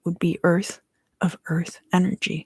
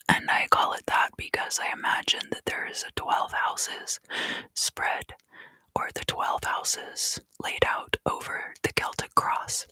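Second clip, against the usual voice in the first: hushed, soft whisper close to the mic; quiet whispered tarot reading; ASMR whisper; 8 dB quieter than usual. Acted. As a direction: whisper it gently like a secret.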